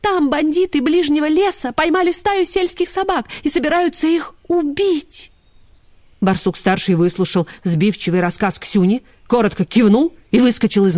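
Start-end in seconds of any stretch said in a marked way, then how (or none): 0.0-5.3 high, anxious report; high little voice in a panic; urgent alarm. A narrator's reading. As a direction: blurt out the bad news. Frightened dog blurts out urgent report.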